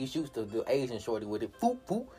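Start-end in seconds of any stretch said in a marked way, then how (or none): 1.6-2.1 mock gunshot sounds. Imitating gunshots.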